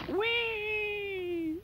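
high, drawn-out squeal; balloon whee. Acted. Giant shooting off like a balloon, long squeal. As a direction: whistle away like a released balloon.

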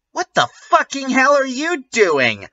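high-pitched furious shriek; angry shout. A narrator's reading. Narrator voicing Ebony shrieking at the door. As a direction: shriek it at the intruder. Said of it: "I shouted angrily".

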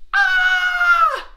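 very high, loud, long shriek; terrified scream. Acted. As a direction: scream like a panicked army. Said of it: "and they'd scream".

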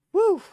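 high, loud "woo"; celebratory whoop. Real, not acted. Streamer celebrating floor three hundred, high whoop. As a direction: whoop it out in relief and triumph.

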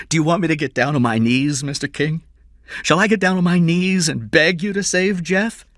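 high, loud, urgent begging; desperate pleading father. A narrator's reading. Father begs his employer to save his son, desperate pleading. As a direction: beg with rising desperation, pitched high.

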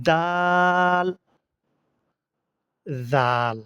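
দাল and জাল with long, level aa; letter demonstration. Shown as a demonstration. Teacher models letters for learners, drawn-out দাল, then জাল. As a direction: hold the vowel, জাল starting with tongue-tip th. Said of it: "জালও সেখান থেকে".

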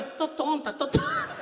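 high, wobbling mock dizzy moan; wife sprawled on the bar floor, mock dizzy; dizzy whimper. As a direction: moan it woozy and helpless.

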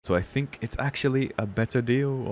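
badly faked French waiter accent, slowing down. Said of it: "Probably the waiter".

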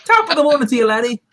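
hammed-up stage Irish greeting; lightly put-on Irish lilt; cheery mock Irish hello; Irish accent. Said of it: "Froggy's gonna have to do an Irish accent".

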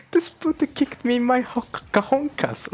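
high, squealed mock Colombian accent; badly faked Colombian accent in pained falsetto; rough Colombian accent. Retelling the man kicked in the groin, high mock Colombian accent. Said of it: "I'm not doing the accent properly".

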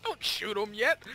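half-strength retold shout; imitated yell. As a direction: imitate a teammate yelling an order. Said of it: "started yelling at me like".

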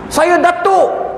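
slowly and grandly, high; pompous. Retold or imitated. Datuk pilgrim announcing his title to the officer, slow and grand. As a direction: announce your rank with pride.

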